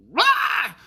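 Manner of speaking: short high roar; angry roar. Retold or imitated. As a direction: roar like a regressed toddler. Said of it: "he's like".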